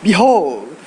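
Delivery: dramatic wildlife narrator voice; nature documentary. Acted. Nature documentary narration over music.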